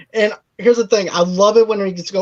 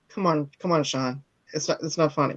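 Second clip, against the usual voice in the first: soft chiding; 4 semitones lower, 9 dB quieter than usual. Retold or imitated. Voices wife's hushed pleading in scene.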